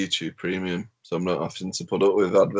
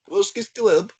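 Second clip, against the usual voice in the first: mock Russian accent; 12 semitones higher, 4 dB louder, slower than usual.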